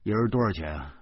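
words round crammed-in sausage; mouth full of sausage. Acted. Narrator stuffing sausages in, mumbling a question to Zhou Pangzi. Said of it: "窝窝囊囊的说".

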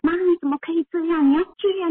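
imitating scolding her mother, voice up high; angry protest. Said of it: "我就会很生气".

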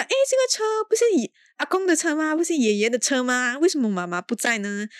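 put-on high, childlike questioning; child's voice. Siblings spot grandpa's car, high childlike voice. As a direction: play the little kid puzzled by the car. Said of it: "然后就讲".

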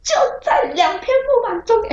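两片木板中间 said high and agitated; insist, all worked up, on 木板. Retold or imitated. Insisting to her brother about the boards, high and worked up. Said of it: "而且我还超激动的哦".

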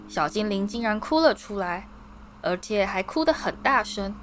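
normal talking voice

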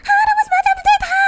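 high, fast mock wail; imitating whiny moviegoer. Friend complaining to me in the cinema, high fast mock wail. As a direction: overplay her pity for the character. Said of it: "她说".

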